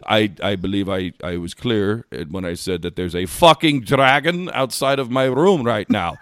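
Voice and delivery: imitation of an exasperated Scotsman; Scottish accent. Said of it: "he's from Scotland originally".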